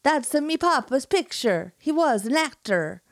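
hammed-up Italian accent, high and loud. Enzo upset, in a hammed-up Italian accent. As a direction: mamma mia in an over-the-top Italian accent. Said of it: "amazing skills for accents".

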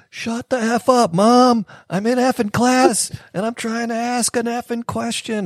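mock teen yelling. Retelling a student swearing at his mom during online class. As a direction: play a bratty kid snapping at mom. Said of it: "he starts yelling at his mom".

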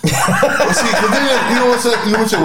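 high laughing voice; laughing excitedly. Real, not acted. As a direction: laugh it out, pitch up.

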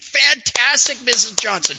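high, loud burst of delight; delighted exclaim; thrilled golf pro. Acted. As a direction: gush like an overjoyed coach. Golf pro thrilled by her putts.

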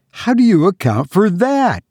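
high, dread-filled wife's question; awful voice. Acted. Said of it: "in an awful voice".

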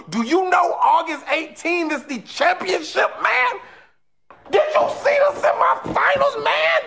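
high excited shouting. Real, not acted. Hyped about the championship.

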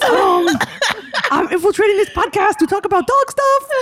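very high, loud, eager cartoon dog voice; squeaky, excited pet voice, laughing; Johnny Waffles dog voice. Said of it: "the voice that you do for your".

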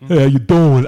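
put-on dopey, thick New York accent; loud mock New York greeting, played stupid; Joey Buttafuoco. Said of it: "who sounds more stupid than Joey Buttafuoco".

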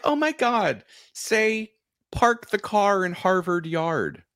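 high, gushing imitation, slowing on the phrase; mock excited request. Strangers begging a New Englander to show his accent, high mock excitement.

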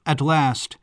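plain reading voice

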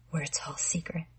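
hushed, creepy secret whisper. Acted. Arnold whispers to Connie at the door, menacing whisper. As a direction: breathe it like a secret.

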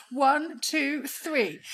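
high, bracing count; mock countdown. Swimmer counting herself into cold pool, bracing. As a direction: count yourself in. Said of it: "And I'm like".

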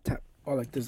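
normal talking voice

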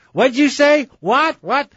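high-pitched mock shout of "What?"; imitating a yell. Imitating a couple yelling between floors, mock shout. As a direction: shout it like a spouse calling upstairs. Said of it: "they start yelling back and forth".

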